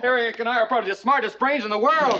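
high-pitched, elated boasting; triumphant. Acted. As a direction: crow over your big success. Announces the birthday is found.

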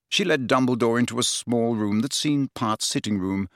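ordinary delivery

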